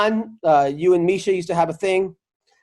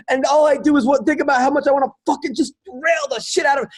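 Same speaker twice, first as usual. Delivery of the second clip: meltdown shout; 7 semitones higher than usual. Retold or imitated. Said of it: "He's like".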